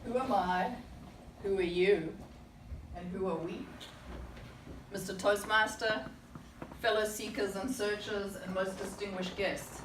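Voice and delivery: normal talking voice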